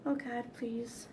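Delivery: very soft scared plea; inner prayer. Retold or imitated. At the lake's edge, hushed frightened prayer. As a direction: breathe it like a silent prayer. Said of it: "I was thinking".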